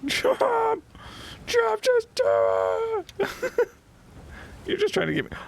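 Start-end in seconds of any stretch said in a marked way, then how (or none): 0.1-3.7 high, drawn-out squeal of chop; giddy high-pitched cheer, stretched slow; excited high yell. Real, not acted. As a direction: squeal it out, laughing. Laughing gamer urging attack.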